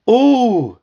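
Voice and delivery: loud, high moan of pain. A narrator's reading. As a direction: moan out a sudden twinge, acted. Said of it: "he moaned".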